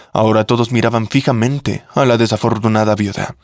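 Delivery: plain reading voice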